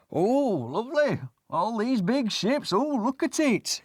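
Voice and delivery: put-on childlike wonder. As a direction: gape at the ships like a kid. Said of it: "think, you know".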